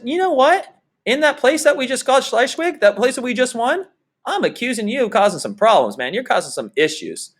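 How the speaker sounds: a hint of a scheming chancellor picking a fight; mock Bismarck. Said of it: "Bismarck says".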